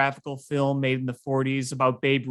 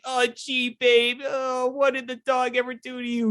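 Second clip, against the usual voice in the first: high-pitched, mock-tearful little boy; hammed-up crying child pleading with Babe; upset kid; 9 semitones higher than usual. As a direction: play the heartbroken kid, voice pitched way up. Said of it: "like, starts crying".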